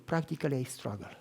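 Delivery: the woman admitting her struggle, hushed; quiet confession. Retold or imitated. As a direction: let the admission drop away.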